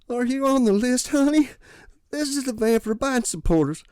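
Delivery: put-on high, proud old lady voice; beaming old lady. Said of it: "she beamed proudly".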